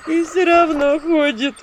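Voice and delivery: high, loud, slow wailing; loud sorrowful keening; distant lament. A narrator's reading. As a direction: moan it from outside. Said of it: "доносились до меня причитания".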